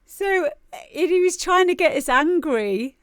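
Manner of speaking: high, loud, laughing voice; talking through laughter. Real, not acted. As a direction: struggle to get it out while cracking up. Laughing while retelling a funny incident.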